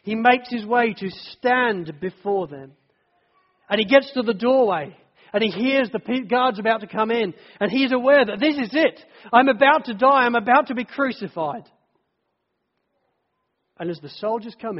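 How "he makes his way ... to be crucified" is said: higher, louder climax of dread; building preaching. Acted. Preacher voicing Barabbas's terror before death, loud rising climax. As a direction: drive it up to a desperate peak.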